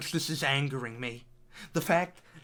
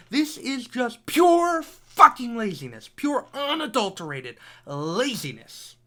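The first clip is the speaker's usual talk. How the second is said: angry outburst; 4 semitones higher, 5 dB louder than usual. Real, not acted. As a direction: hammer out the word laziness in rage. Ranting about game laziness.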